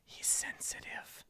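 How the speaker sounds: a hushed whisper over the shoulder, meant for one ear; sly whispered aside about his husband. Acted. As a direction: lean in and breathe it as a private dig. [name] mutters aside over shoulder.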